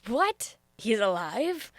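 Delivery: mock astonished what; retold disbelief. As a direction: gasp at the news. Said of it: "I was like".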